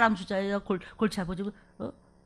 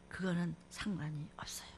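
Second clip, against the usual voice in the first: whisper; 7 semitones lower, 14 dB quieter than usual. Real, not acted. Preacher drops to a whisper.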